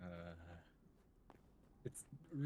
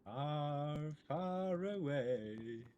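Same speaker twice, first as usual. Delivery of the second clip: slow, stretched far away; drawn out; much slower than usual. Pretend. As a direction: stretch the words out slowly. Drawn out, echoing the line.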